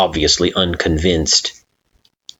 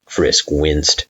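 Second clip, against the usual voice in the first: plain reading voice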